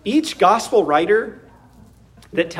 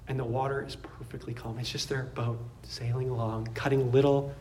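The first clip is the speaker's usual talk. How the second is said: soft, low, peaceful description; hushed calm; 5 semitones lower, 14 dB quieter than usual. Real, not acted. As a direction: let the voice settle like still water. Pictures perfectly calm water after storm.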